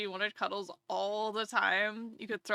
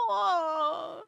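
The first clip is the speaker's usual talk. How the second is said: a lilting aw for a sweet cat; affectionate aw; cooing aw; 7 semitones higher, 3 dB louder than usual. Real, not acted. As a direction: coo it fondly. Cooing at pet.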